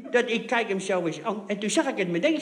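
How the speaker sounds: usual speaking voice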